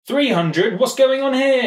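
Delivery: high, loud startled question; shocked by the camera setting; baffled. Real, not acted. Camera setting surprises him.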